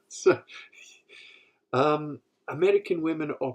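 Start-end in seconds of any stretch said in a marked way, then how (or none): none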